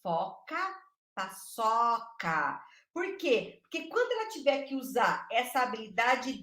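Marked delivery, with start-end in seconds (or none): 0.0-2.6 paçoca drawn out very slowly; slow rhyme demo. Shown as a demonstration. Teacher showing children to say paçoca very slowly. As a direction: lay out each syllable of paçoca. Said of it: "ela que tem que falar".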